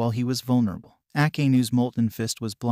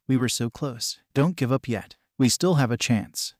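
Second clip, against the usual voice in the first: ordinary delivery, nothing marked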